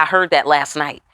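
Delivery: ordinary delivery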